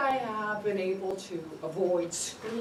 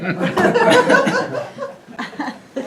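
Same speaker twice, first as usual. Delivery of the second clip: a high loud burst of laughter; big laugh; 13 dB louder than usual. Real, not acted. After the California stop joke, loud laughter. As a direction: crack up at your own punchline.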